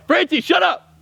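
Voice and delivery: high, loud yell at someone in the room; sharp yell. Real, not acted. Yelling at Francie in the room to shut up, loud and sharp. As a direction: cut them off with a shout.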